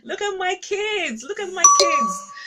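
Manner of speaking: a delighted parent bragging, with a laugh at the start; joyful Daddy God boasting over his children; proud father God. Retold or imitated.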